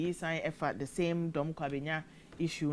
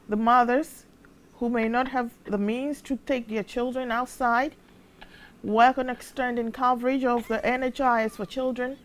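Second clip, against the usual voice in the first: higher, louder mock American accent; 6 semitones higher, 6 dB louder than usual. Presenter reading a listener's comment on air, mock American accent. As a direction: read it out loudly in a put-on American accent. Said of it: "no say in American accent".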